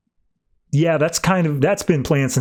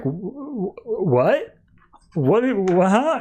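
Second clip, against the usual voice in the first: higher, stuttering shock; stunned stammer; 7 semitones higher, much slower than usual. Retold or imitated. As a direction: stammer after the stab. Midnighter stabbed, in shock.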